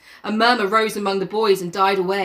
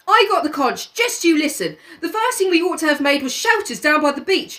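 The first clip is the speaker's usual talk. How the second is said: much higher scolding schoolboy, half laughing; high boy ranting at the others; Piggy losing his temper; 8 semitones higher than usual. Acted. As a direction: tell the boys off in Piggy's voice. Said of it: "Piggy lost his temper".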